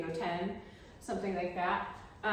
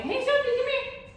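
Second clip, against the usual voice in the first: loud, high, pushy call to the dog; too forward call; 11 dB louder than usual. Shown as a demonstration. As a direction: call her in too eagerly and loudly. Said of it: "as forward as going".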